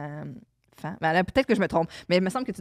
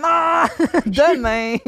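lazy putting it off; 6 semitones higher, 5 dB louder, much slower than usual. Retold or imitated. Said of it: "il est comme".

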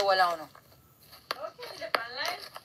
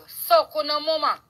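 usual speaking voice

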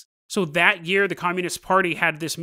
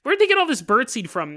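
higher, feigned bafflement; mock puzzled mom; 6 semitones higher, 4 dB louder than usual. Mom covering for her daughter, higher mock puzzlement. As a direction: pretend innocent confusion.

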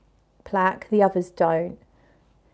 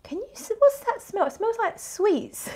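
much higher, put-on voice for the man at the party; mock the guy sniffing the air, pitched way up; quoting a man; 13 semitones higher than usual. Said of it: "and the guy in the group said".

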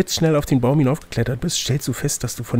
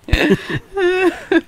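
high-pitched burst of laughter. Real, not acted. GM laughs at tattoo regret. (hohes Auflachen)

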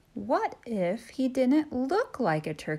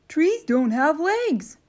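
louder, higher stern elf; christmas elf; 8 semitones higher, 6 dB louder than usual. Acted. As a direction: send the turkey packing. Elf voiced.

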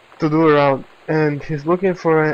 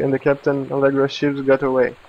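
ordinary talk, nothing marked

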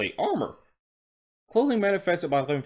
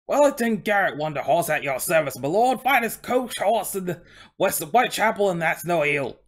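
loud, high, proud Cockney horse voice; Cockney coach horse; 5 semitones higher, 4 dB louder than usual. Acted. Horse introducing himself to interviewer, Cockney accent. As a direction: put on a broad, hearty Cockney. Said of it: "he's speaking in a Cockney accent".

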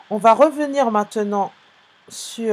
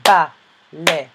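"baleine" in three separate beats, BA... LEI... NE; syllable by syllable; 4 semitones lower, 6 dB louder than usual. Shown as a demonstration. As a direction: pause after each syllable of "baleine". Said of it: "deuxième syllabe". (« baleine » découpé en trois syllabes)

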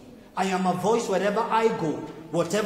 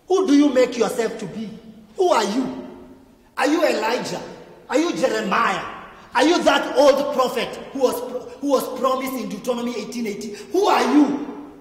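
high, loud, pressing questions; demanding interrogation; 4 semitones higher, 6 dB louder than usual. Retold or imitated. Pharisees interrogate John the Baptist.

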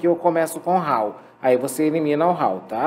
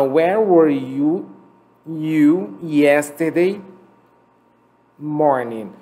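slow model line; 3 dB louder, much slower than usual. Shown as a demonstration. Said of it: "dialogue model read slowly".

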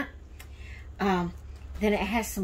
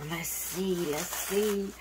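ordinary delivery

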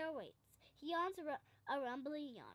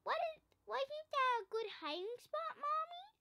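put-on much higher eager child's question; a proud cub asking mommy for praise; little bear voice; 10 semitones higher, 4 dB louder than usual. A narrator's reading. Little bear asks mother proudly.

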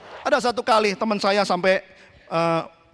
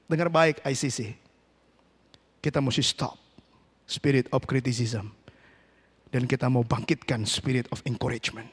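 low, soft pastoral appeal; solemn hushed preaching; 9 semitones lower, 5 dB quieter than usual. Real, not acted. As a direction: drop down low and speak gently to the congregation. (khotbah pelan, rendah dan khidmat)

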